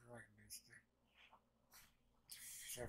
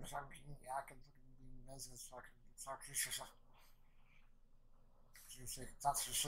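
ordinary delivery

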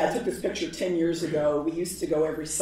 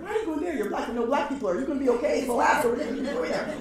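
much higher, worried rush of questions; worried disbelief; 7 semitones higher, 3 dB louder, faster than usual. Retold or imitated. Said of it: "He's like".

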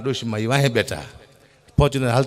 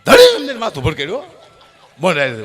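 usual speaking voice

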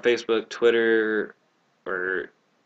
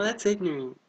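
high mocking voice; 8 semitones higher, 4 dB quieter than usual. Mimicking phrase again.

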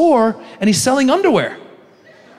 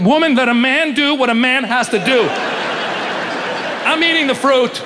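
higher, grand husband's declaration; mock-heroic defiance; 7 semitones higher than usual. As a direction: proclaim it as a hammed-up manly stand. Mock-heroic husband defies wife.

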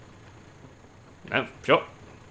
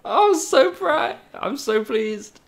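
high slow drawn-out gush; gushing pride; 11 semitones higher, slower than usual. Real, not acted. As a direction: coo it out proudly. Gushing over the success.

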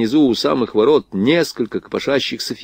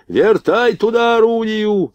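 high, drawn-out yelled order; urgent shout; 11 semitones higher, slower than usual. A narrator's reading. Gunner orders the gun swung round, shouting urgently. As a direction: yell it out, pitched way up. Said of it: "закричал Блохин".

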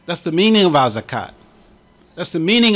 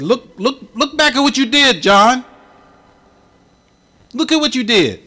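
much higher, louder commanding voice; angel's command; 9 semitones higher, 5 dB louder than usual. Retold or imitated. Angel rebuking John at the last breath, high and commanding. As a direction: call out look, look, insistent and rising. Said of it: "he said".

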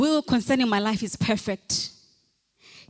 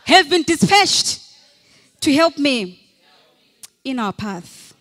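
said like you mean it; 4 semitones higher, 9 dB louder, slower than usual. Shown as a demonstration. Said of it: "say it like you mean it".

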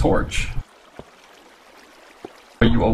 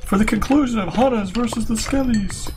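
high, hammed-up broadcast voice; TV announcer; 9 semitones higher than usual. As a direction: announce it like a TV host. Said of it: "a word from our sponsors".

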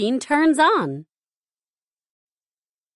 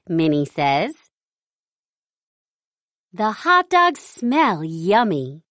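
ordinary delivery

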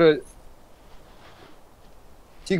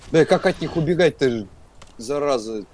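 ordinary delivery

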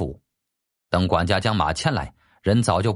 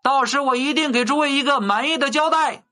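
shouting through a horn. Acted. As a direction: address the whole crowd through a makeshift megaphone. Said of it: "将物件细的一边抵在唇边喊道". (用喇叭高声向人群喊话)

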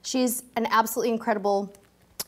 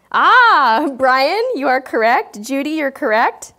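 louder, delighted praise; excited; 3 semitones higher, 7 dB louder, slower than usual. Real, not acted. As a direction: cheer the right guess. Chat guesses the destination.

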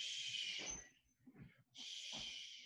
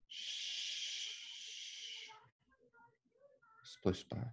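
ordinary delivery, nothing marked

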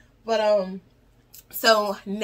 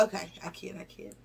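normal talking voice

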